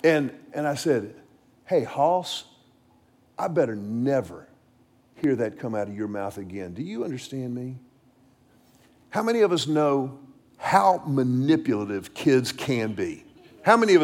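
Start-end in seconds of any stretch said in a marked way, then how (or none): 1.7-7.8 low, soft and firm, no yelling; a father's steady quiet threat, much lower and softer; quiet stern warning. Retold or imitated. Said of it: "I didn't yell in this instance".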